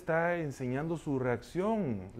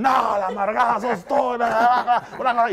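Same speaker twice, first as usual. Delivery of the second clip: high, loud squawking retort with a laugh; mock Donald Duck tantrum; 8 semitones higher, 9 dB louder than usual. Preacher mimics yelling back at his wife in a squawky duck voice. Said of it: "subió el pato Donald".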